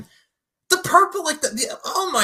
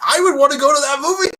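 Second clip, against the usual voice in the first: excited; 9 semitones higher, 9 dB louder than usual. Retold or imitated. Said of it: "I'm like".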